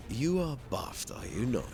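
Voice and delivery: normal talking voice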